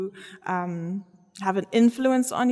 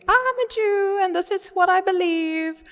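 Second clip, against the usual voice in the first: loud, high, put-on proclaiming voice; mock shout; 9 semitones higher, 3 dB louder than usual. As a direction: announce it loudly to everyone. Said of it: "didn't have to go shout to everybody".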